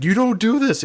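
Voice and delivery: high-pitched retold disbelief; indignant audience. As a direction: voice an appalled crowd's objection. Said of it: "Their minds were like".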